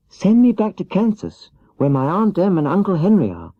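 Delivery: put-on little girl earnestly pleading with the wizard; earnest Dorothy. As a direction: beg sincerely to go home. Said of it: "she answered earnestly".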